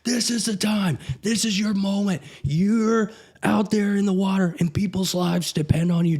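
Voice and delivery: high, intense pool-deck pep talk from a drill instructor; motivating instructor. Retold or imitated. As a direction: fire up the exhausted trainees like a Navy instructor. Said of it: "and he was like".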